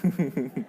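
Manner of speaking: short soft chuckle; quick quiet laugh. Real, not acted. Laughs at the joke.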